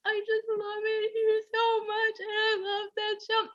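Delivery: high, tearful voice breaking into a wail; crying. Retold or imitated. Sobbing to the strangers why she is crying, voice breaking. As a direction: sob it out, squeezed and high. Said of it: "I'm just crying".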